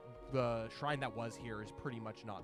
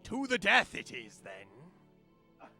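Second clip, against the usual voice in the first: pirate captain voice; 6 semitones higher, 11 dB louder, much slower than usual. Acted. Captain accepts duel.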